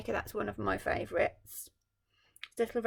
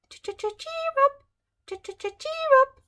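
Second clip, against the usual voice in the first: high chirpy birdsong voice; bird call; 12 dB louder, slower than usual. Acted. The little brown bird singing to the Emperor, high chirp. Real nightingale sings.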